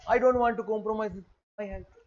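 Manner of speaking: slow, soft mocking quote; mocking the health-conscious diner; mock prim health talk. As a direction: drag it out to mock the hypocrite. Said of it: "ऊपर से कह रहा है".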